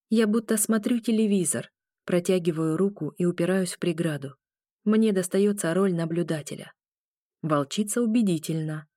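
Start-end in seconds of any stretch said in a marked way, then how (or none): none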